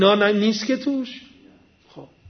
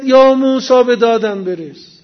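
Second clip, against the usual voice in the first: raised, louder mock cry; retold call for help; 2 semitones higher, 7 dB louder than usual. The man calls on Moses to save him, retold cry. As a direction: call on Moses loudly, as a quoted plea. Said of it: "که صدا زد".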